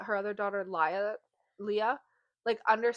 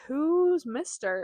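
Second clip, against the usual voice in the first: slow, higher-pitched puzzled question; imitating a puzzled mom; 6 semitones higher, 6 dB louder, much slower than usual. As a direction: draw out the question, louder. Voices mother questioning the child.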